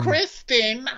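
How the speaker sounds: high, drawn-out name; mock exasperated princess. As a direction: call after a sulking lover in a put-on voice. Princess calling to crying knight.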